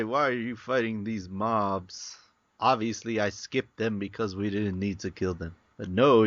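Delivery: ordinary talk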